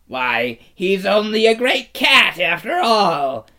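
high, loud mocking creature; jeering cry. A narrator's reading. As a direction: sneer as if relieved. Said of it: "cried one".

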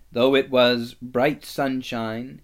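plain reading voice

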